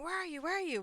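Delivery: high, loud urgent calling; frantic calling. Retold or imitated. Man in the ad phoning his friend, frantic loud calls. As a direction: shout down the phone for a friend.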